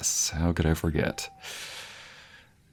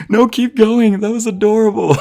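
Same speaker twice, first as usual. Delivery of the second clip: much higher and louder, giddy; squealing delight; 9 dB louder than usual. Acted. Teasing her man to keep laughing, giddy squeal. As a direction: squeal it out with glee.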